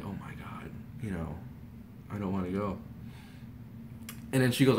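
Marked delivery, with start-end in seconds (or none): 0.0-2.8 low, very soft reluctance; dejected. Retold or imitated. Son told by mom they must leave, dejected. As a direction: mumble it softly, deflated.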